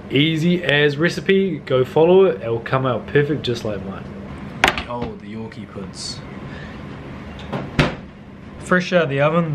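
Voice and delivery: ordinary delivery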